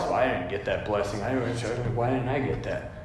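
low, soft jealous grumble; jealous sulking. Retold or imitated. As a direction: mutter it like a sulking envious child. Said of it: "And you're jealous".

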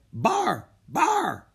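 high, loud barking seal. Acted. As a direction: bark like a playful seal. Seals barking in the story.

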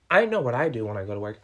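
fast, loud and forceful; sudden loud outburst. Real, not acted. Defending himself to viewers, loud and fast. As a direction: blurt it out, fired up.

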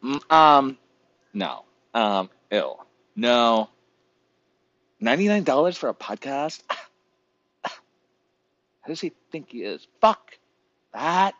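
hammed-up snooty dismissal; mocking hairstylist. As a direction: sniff at the price like a diva stylist. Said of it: "They're like".

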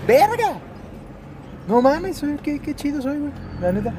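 más agudo y más fuerte, emocionado; suéltalo con euforia creciente; eufórico. Real, not acted. Excited self-praise while driving. (emocionado, se felicita a sí mismo)

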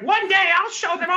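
high, loud scheming villain voice; Starscream impression. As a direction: sneer it like a cartoon traitor. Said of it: "talks like Starscream".

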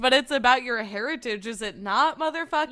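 loud, rising mock-angry retort; mock outrage. Answering a man from the news for a co-host, mock outrage. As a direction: tell them off loudly, half laughing.

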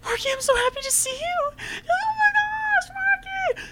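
high-pitched ecstatic squeal, near tears; squealing fangirl. Retold or imitated. Retelling a fan girl squealing at the mascot. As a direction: gush like a thrilled fan meeting her idol. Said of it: "She goes".

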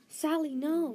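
loud, high cry of warning; alarmed shout. A narrator's reading. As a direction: shout to stop the dog. Luna cries out to stop her dog.

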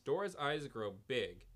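ordinary delivery

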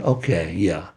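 low, soft, half-hearted okay; unimpressed wife. Retold or imitated. Wife humoring her bragging husband, low and unimpressed. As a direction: humor him quietly, not buying it. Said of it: "and she's saying".

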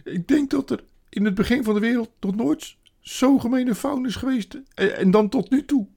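high, loud self-reproach; woeful creature crying out; tearful wailing faun. A narrator's reading. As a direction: bewail your own wickedness. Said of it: "de faun bleef hartverscheurend snikken".

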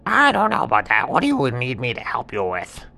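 lightly voiced cheeky monkey; monkey hesitating to help. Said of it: "And the monkey said".

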